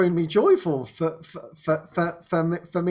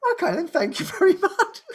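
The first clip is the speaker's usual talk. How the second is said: laughing thanks; 9 semitones higher than usual. Retold or imitated. Mock-grateful reply to advice.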